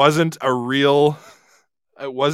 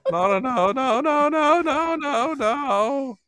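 mock nos climbing higher and higher; rising silly nos; 10 semitones higher, 3 dB quieter, slower than usual. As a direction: ramp each no up in pitch like a toy. Playing with the no sound.

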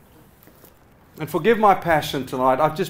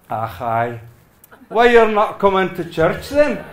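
put-on Scottish accent; 3 dB louder than usual. Preacher retelling the old Scottish pastor's question, in a Scottish accent. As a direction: try a Scottish brogue you half believe you can pull off. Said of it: "I wish I was bold enough with my Scottish accent".

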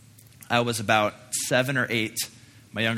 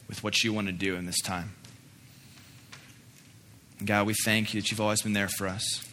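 closing prayer. Acted.

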